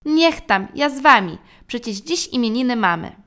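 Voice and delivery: cheerful giving in. A narrator's reading. Father catches up on his cushion, cheerful and loud. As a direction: shout it gladly as you catch up. Father gives in, joins flight.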